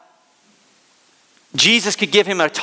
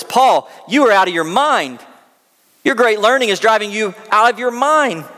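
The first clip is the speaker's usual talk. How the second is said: raised, louder accusing voice; 6 semitones higher than usual. Acted. Governor Festus interrupting Paul's defense, with raised voice. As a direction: call Paul out across the courtroom. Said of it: "said with a loud voice".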